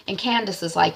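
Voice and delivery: ordinary talk, nothing marked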